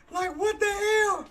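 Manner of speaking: incredulous laugh; laughing disbelief. Real, not acted. Disbelief at the move.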